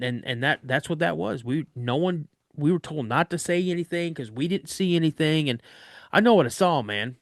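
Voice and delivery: usual speaking voice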